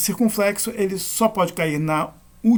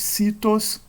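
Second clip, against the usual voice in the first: "Citos" stressed on its first syllable, CI; penultimate stress; 4 semitones higher than usual. Shown as a demonstration. Teacher showing circumflex examples, "Citos" stressed on CI. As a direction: hit CI in "Citos". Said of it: "Na penúltima".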